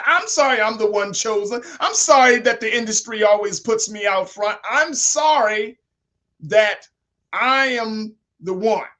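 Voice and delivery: loud retold apology, slowing to a weighty finish; emphatic quoted apology. Host repeating the actress's "I'm sorry" for listeners, emphatically quoted. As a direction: hammer out each phrase of the imitated apology.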